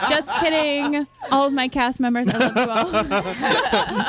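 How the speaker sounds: joking through real laughter; laughing reassurance. Real, not acted. Director teasing cast about recasting, laughing. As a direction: laugh it off, drawing out the end.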